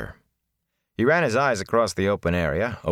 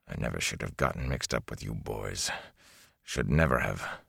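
much lower, softer growling voice; 10 semitones lower, 8 dB quieter than usual. A narrator's reading. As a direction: growl it under your breath. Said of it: "Tom growled".

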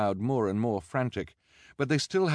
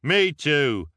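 very loud crowd shout; crying out; 8 semitones higher, 9 dB louder than usual. A narrator's reading. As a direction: yell your agreement from nearby. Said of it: "cried someone else standing nearby".

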